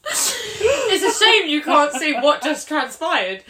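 high, slow words squeezed through laughter; helpless laughter. Real, not acted. Podcaster telling listeners what they missed, laughing helplessly. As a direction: struggle to speak while cracking up.

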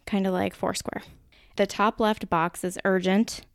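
usual speaking voice

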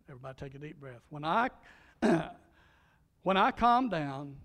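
ordinary delivery

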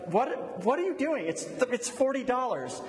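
high, incredulous mock cashier; acted bafflement. Cashier to haggling customer at Dillard's, mock incredulous.

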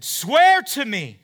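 slow, loud, high yell of the king. Retold or imitated. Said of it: "yells to David".

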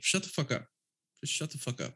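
quiet, serious command, softening on the repeat; understated no-nonsense order; means business. Acted. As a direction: say it without raising your voice, meaning it. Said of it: "I mean business".

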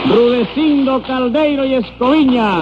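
high, slow drawn-out name call; high-pitched court crier; roll call. Acted. Court clerk calling the roll.

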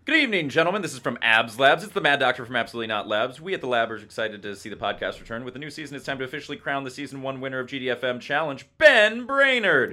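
hosting a mock awards update; announcer-style reading. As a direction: read it out like an announcer, building to a long, high name reveal. Reads a listener's update aloud.

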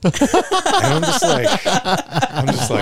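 high, slow words squeezed out through real laughter; laughing. Real, not acted. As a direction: break up laughing mid-sentence. Cracking up at the punchline.

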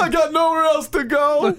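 high, slow, desperate movie plea; Richard Gere movie quote. Retold or imitated. Host to cohost, quoting the desperate cadet in a high, slow plea. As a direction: ham up the cadet begging to stay. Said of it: "You ever see Officer and a Gentleman?".

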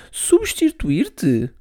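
put-on high, slow pleading little girl; sweetly begging her brother, very high; little sister voice. Millie voice, innocent. (voz de menina a pedir, muito aguda)

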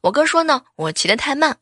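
normal talking voice

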